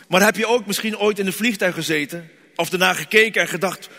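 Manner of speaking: ordinary delivery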